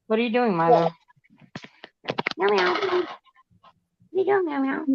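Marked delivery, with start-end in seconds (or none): 2.4-4.8 high, soft, slow pet-talk voice; high soft baby talk for the kitty; cat baby talk. Pretend. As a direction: coo at the cat in a high little voice.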